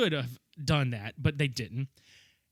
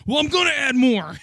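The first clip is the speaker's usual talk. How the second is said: loud, high hammed-up threat; mock menacing yell; 8 semitones higher, 8 dB louder than usual. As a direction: bark it like a deranged captor. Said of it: "He's like".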